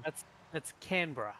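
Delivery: Canberra in two beats, CAN-bruh; correct pronunciation. Shown as a demonstration. Host repeating it for everyone, correct CAN-bruh pronunciation. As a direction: clearly model Canberra with the middle vowel dropped. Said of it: "say it again, [name]".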